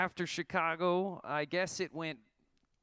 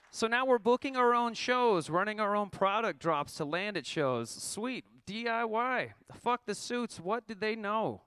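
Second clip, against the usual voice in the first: triumphant; 7 semitones higher, 4 dB louder than usual. Real, not acted. Triumphant booking own shows.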